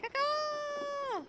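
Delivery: high crow caw, called out; chirping bird person. Pretend. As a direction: caw back at the birds like a crow. Answering the birds outside.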